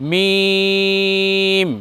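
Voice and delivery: মিম held long, lips closed; teacher modelling the letter slowly; drawn-out letter name. Shown as a demonstration. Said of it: "এই বর্ণ আমরা উচ্চারণ করব".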